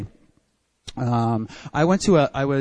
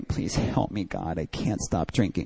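crying prayer; 8 semitones lower, 8 dB quieter than usual. Retold or imitated. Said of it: "start crying".

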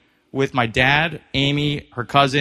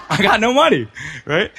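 laughing; 7 semitones higher, 5 dB louder than usual. Real, not acted. Laughing at own broke state.

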